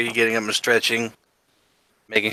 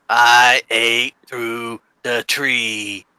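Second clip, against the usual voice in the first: very slow, word by word; slow spelling-out; much slower than usual. Acted. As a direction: stretch out each word deliberately. Turtle spells out his deed.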